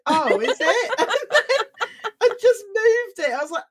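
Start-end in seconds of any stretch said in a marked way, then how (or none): 0.1-1.0 laughing. Retold or imitated. 1.0-3.3 laughing through the words; giggling as she tells it. Real, not acted. Laughing while telling story.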